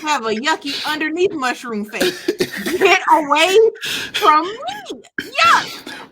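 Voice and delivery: high, loud squeal of disgust; disgusted recoil. Acted. Telling co-hosts about creature faces, disgusted.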